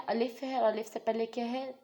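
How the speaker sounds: ordinary talk, nothing marked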